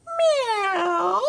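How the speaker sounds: high squeaky meow; cat meow. Acted. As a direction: meow like a little kitten. What does the cat say?.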